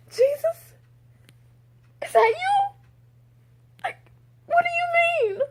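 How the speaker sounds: very high-pitched squealed shock; astonished disbelief. Retold or imitated. Retelling the boss exchange, astonished squeal. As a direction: squeak it out in stunned bursts.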